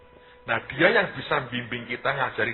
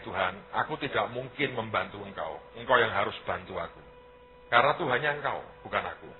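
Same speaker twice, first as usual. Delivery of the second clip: quiet surrendered prayer; 3 semitones lower, 8 dB quieter than usual. Retold or imitated.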